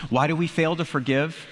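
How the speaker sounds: ordinary talk, nothing marked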